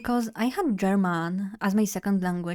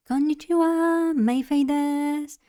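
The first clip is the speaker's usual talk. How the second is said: much higher-pitched Japanese self-introduction; high, sustained Japanese introduction voice; 7 semitones higher than usual. Shown as a demonstration. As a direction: lift the voice way up for a Japanese greeting. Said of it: "I go pretty high".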